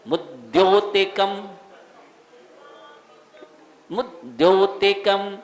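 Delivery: मुद्योतिकम slowly, mud held, then dyo; joined consonant. Shown as a demonstration. Teacher showing d and ya joined, joined consonant. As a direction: lean firmly on the join in मुद्योतिकम. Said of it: "जोड़ लगाएंगे यहाँ पर".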